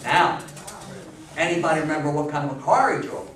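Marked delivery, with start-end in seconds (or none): none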